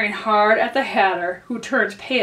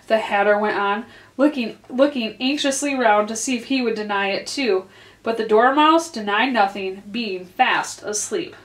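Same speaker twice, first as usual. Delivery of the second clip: usual speaking voice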